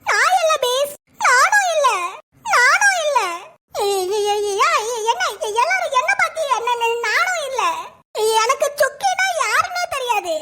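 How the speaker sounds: high, whining denial; whiny schoolgirls. Acted. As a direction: plead innocence like scared kids. Students denying in chorus. (சிணுங்கும் மாணவிகளின் குரல்)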